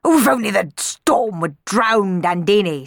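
loud, seething baron; bitter, angry wish. A narrator's reading. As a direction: seethe in a put-on, forceful voice. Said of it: "seethed the Baron".

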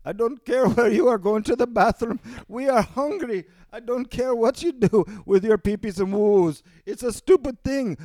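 high, loud put-on Indian voice; exasperated voice from India; Indian man voice. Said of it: "somebody over in India right now going".

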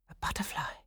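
soft breathy hush; whispered wonder. A narrator's reading. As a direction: breathe it in awe.

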